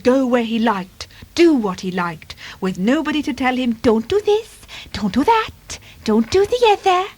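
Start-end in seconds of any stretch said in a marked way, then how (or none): 3.7-7.0 high-pitched scolding, rapped out as a list; mock bossy don'ts, pitched up; mimicked nagging orders. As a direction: nag in a high put-on voice. Imagined orders mimicked in story.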